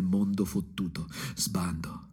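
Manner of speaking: sussurrato, molto più piano e più basso; sussurro amaro. Acted. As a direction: lascia cadere le parole come un segreto sporco. Bitter confession sinking down. (sussurro cupo e rassegnato)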